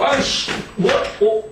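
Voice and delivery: sudden high-pitched irritated yelp; high, startled "what was that?" reaction; startled annoyance. Acted. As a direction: act out tripping and cursing in surprise. Acts out tripping over something.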